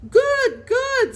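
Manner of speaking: very high, loud screeched cries. A narrator's reading. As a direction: screech it like a crazed bird. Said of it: "screeched Billy".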